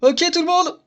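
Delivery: high-pitched call to the whole group; club leader calling out. Acted. Club leader addressing everyone before sharing poems, calling out high. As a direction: pipe up to get everyone's attention.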